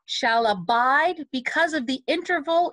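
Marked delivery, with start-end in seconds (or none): 0.1-1.3 slow "abide" with a long, weighted second syllable; emphasis on BIDE. Shown as a demonstration. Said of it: "emphasize the bide".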